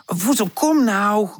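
high, drawn-out nagging plea; impatient. A narrator's reading. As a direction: urge a dawdler along impatiently. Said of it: "Pip duwt ongeduldig". (ongeduldig aandringen)

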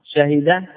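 شهد as sha-hi-da; three-syllable شهد: SHA-hi-da; original full form. Shown as a demonstration. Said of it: "يجوز فيه أربع لغات".

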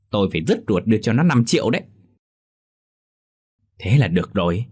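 ordinary delivery

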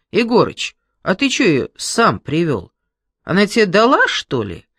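loud mocking woman; teasing boss. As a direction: jeer at him, loud and coarse. Boss woman mocks Egorych.

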